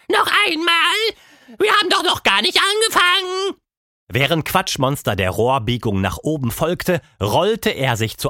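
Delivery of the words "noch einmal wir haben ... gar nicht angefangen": sehr hohe, laute Monsterstimme; brüllendes Monster. A narrator's reading. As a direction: Noch einmal langsam und hoch hinausrufen. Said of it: "brüllte ebenfalls". (ausgelassenes Quatschmonster ruft laut und sehr hoch)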